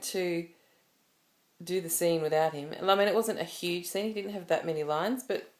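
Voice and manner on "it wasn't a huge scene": usual speaking voice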